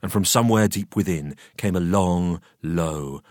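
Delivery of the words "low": usual speaking voice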